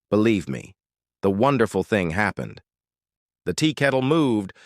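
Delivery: plain reading voice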